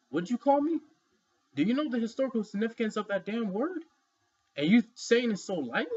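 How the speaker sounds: acted-out offended questioning; mock confrontation.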